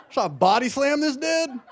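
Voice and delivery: ordinary talk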